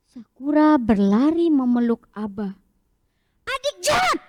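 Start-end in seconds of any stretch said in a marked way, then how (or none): 3.5-4.2 high, sharp accusing cry of a cross big brother; angry child. Acted. Big brother scolds his sister for breaking his castle, angry and high. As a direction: snap at the little sister. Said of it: "Tetap begitu kata Abang Syabasnya".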